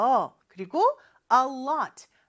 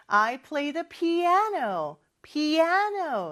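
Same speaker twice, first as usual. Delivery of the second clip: "piano" with the middle syllable "a" stressed and stretched; slow teaching model, "piano" stressed pi-A-no; stress on second syllable; 5 semitones higher, much slower than usual. Shown as a demonstration. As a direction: punch and lengthen the "a" in "피아노" and "piano". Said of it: "두 번째 음절에 강세가 있는".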